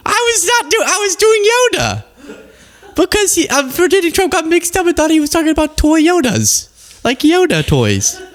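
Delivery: high, giggly explaining; laughing. Real, not acted. Host explaining the joke to viewers, high and giggly. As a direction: explain it while still laughing. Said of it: "laughing explanation".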